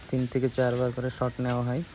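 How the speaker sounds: normal talking voice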